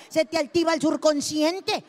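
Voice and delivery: usual speaking voice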